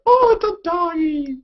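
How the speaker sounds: high, slow cooing over a puppy; gushing viewer. Retold or imitated. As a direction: coo at the screen like a dog lover. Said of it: "People would be like".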